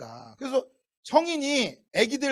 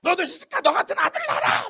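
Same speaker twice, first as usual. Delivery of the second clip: much higher mock scolding cry; mother's scolding curse; 8 semitones higher than usual. Mother cursing her rude son, high scolding cry. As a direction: shriek it like a fed-up mom. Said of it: "막 이러잖아요".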